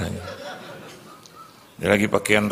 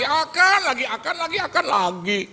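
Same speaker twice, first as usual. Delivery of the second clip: much higher sing-song complaint; mock exasperation. Preacher mocks God's akan, high exasperated whine. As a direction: groan at the word akan.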